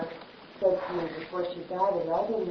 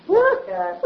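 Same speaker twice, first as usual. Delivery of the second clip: excited; 8 dB louder than usual. Real, not acted. Excited agreement about morning practice.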